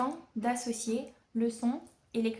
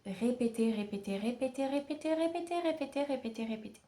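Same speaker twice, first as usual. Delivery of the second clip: insistent repetition; 3 semitones higher than usual. Shown as a demonstration. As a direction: run the repeats together in one rolling string. Acting out endless repetition.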